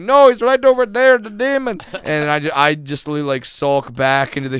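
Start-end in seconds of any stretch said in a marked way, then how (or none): 0.0-1.8 high, loud desperate protest; hammed-up panicked man, raised voice; frantic pointing. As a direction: insist frantically while being tackled. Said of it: "and he's like".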